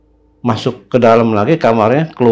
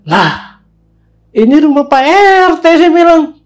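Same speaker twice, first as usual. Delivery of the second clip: high-pitched, louder exclamation; astonished realisation; 4 dB louder than usual. Retold or imitated. Storyteller recognising the neighbourhood head's house, astonished. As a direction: blurt the discovery high, stretching the end.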